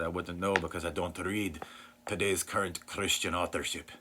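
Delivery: mildly exaggerated Scottish accent; a light put-on Scottish accent, playfully overdone; Sinclair Ferguson's Scottish accent. Pastor quoting a panel answer in a lightly exaggerated Scottish accent. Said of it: "I'm probably exaggerating his accent".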